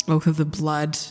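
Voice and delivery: ordinary talk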